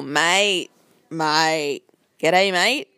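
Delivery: broad Bonza Aussie accent demo; punchy, drawn-out Australian "mate"; Ocker Australian accent. Shown as a demonstration. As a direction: show off an Ocker Australian twang. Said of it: "like, Bonza or Ocker or, um, Australian".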